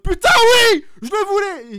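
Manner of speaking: cheer, high and loud; fan en délire. Retold or imitated. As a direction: revit sa joie de fan qui crie. Retells cheering Cena's arrival. (fan qui hurle de joie)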